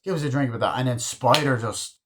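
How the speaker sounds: normal talking voice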